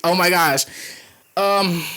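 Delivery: ordinary delivery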